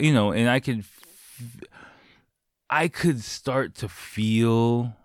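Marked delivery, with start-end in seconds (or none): none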